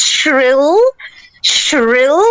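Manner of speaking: a touch of hammed-up shrillness; overdone shrill demo. Shown as a demonstration. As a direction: stretch "shrill" to mimic the sound. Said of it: "I may have overdid it just a little bit".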